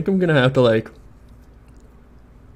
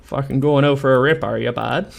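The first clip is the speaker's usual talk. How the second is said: hammed-up Canadian accent, louder at the start; 6 dB louder than usual. Streamer proving they're Canadian to chat, hammed-up Canadian accent. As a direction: put on a mock Canadian accent for a joke. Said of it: "that racist Canadian accent that I do".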